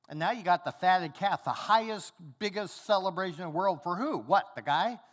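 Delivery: mock indignant son griping at his father; indignant rant. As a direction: rant at your father about the unfairness. Elder son ranting at father.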